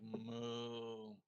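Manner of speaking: slow, low, sleepy moo; just-woken cow. Shown as a demonstration. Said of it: "It just woke up".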